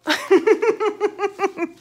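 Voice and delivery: high gleeful cackle; cackling laugh. Acted. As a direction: cackle at your own prank. Laughs after scaring.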